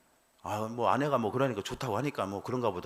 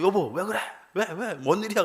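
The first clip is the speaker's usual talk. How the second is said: alarmed; 8 semitones higher than usual. Retold or imitated. Husband startled by wife crying.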